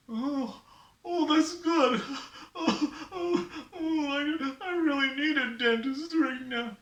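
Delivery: high, whimpering put-on sobs; tearful toothache sufferer. Sufferer really needs a dentist, hammed-up tearful whimper. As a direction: wail through the pain like a cartoon patient. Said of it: "Don't cry".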